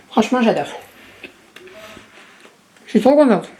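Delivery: talking with her mouth full. Real, not acted. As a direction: speak around a mouthful of doughnut. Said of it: "je parle la bouche pleine".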